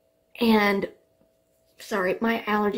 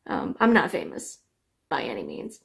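normal talking voice

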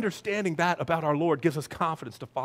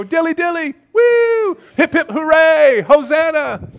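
high, loud hammed-up cheering; rowdy toast; 11 semitones higher, 10 dB louder, slower than usual. Revellers holding up a Diet Coke, loud mock cheering. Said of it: "hold up our Diet Coke and go".

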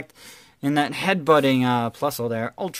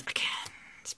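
whisper; 13 dB quieter than usual. Real, not acted. Repeats the card name hushed.

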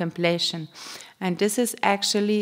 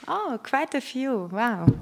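pleasantly surprised; 6 semitones higher than usual. Real, not acted. Guide seeing many newcomers' hands, pleasantly surprised. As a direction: react with real surprise, pitch lifting. Sees many first-time visitors.

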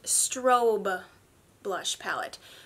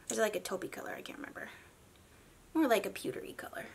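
soft unvoiced musing to herself; whispered aside; 8 dB quieter, much faster than usual. Real, not acted. Beauty vlogger whispering to herself mid-swatch. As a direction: whisper it while thinking aloud.

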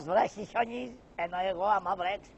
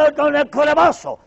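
threatening shout; 8 semitones higher, 10 dB louder than usual. Acted. Threatening to beat the man.

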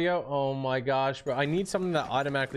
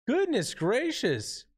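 exasperated; 10 semitones higher, much slower than usual. Real, not acted. Exasperated after being hit.

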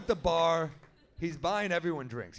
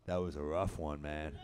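much lower and softer, a local tough guy; Stu's voice; 11 semitones lower, 4 dB quieter than usual. Retold or imitated. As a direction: drop way down into Stu's low voice. Stu's deadpan reply, voiced.

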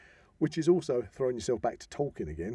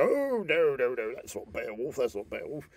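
a hint of a pedantic fan scoffing; mock purist. Podcaster mimics a purist film fan objecting, lightly mock-scoffing. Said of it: "unless you're an absolute purist, and go".

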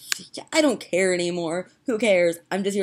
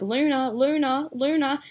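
higher, drawn-out Australian "Luna"; Australian accent; 8 semitones higher than usual. Retold or imitated. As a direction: call the dog in an Australian accent. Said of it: "talking in an Australian accent".

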